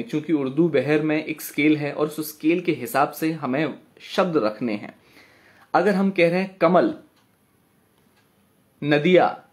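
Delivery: usual speaking voice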